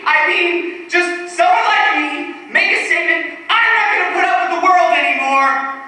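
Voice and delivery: high, loud, worked-up declaration; defiant outburst. Acted. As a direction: build to a defiant cry against the world. Declares a defiant violent statement.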